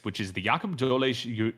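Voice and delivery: normal talking voice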